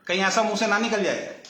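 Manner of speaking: ordinary talk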